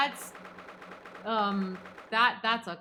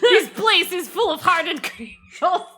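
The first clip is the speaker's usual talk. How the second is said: loud, high, laughing fake Russian; badly faked Russian accent through giggles; makeshift Russian accent; 8 semitones higher, 12 dB louder than usual. Player improvises a voice for the prison governor, laughing Russian accent. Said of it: "'cause I'm Russian".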